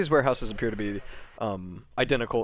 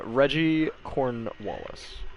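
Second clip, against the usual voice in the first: slow, deliberate Reggie Cornwallis; careful saying; 4 semitones higher, much slower than usual. Real, not acted. Game master trying hard not to botch a silly name, slow and careful. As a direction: draw the name out carefully, resisting a slip. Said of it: "he's trying really hard not to".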